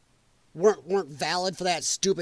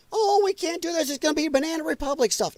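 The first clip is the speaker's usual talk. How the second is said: high mocking whine, quicker at the end; mocking whiny objector; 8 semitones higher than usual. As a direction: parody the hand-wringers in a high voice.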